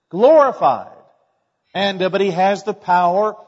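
loud, climbing sermon voice; triumphant preaching. Acted. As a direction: lift the room with it. Pastor preaching the resurrection.

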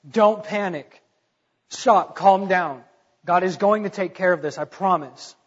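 loud urgent plea to calm down; exhorting. Retold or imitated. Moses urging terrified Hebrews to calm down, loud and urgent. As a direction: raise the voice to stop a panic. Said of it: "He's exhorting them".

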